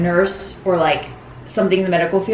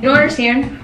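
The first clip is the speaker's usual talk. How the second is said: ordinary talk, nothing marked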